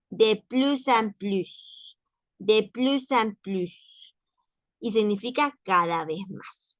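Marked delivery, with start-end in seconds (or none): 0.1-4.2 de plus en plus slowly, S linked into en; liaison de plus en plus. Shown as a demonstration. Teacher drilling de plus en plus for her class. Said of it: "entonces se pronuncian así".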